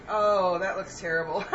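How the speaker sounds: laughing at the ruined sauce. Real, not acted. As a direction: chuckle through the words. Sauce turned out badly.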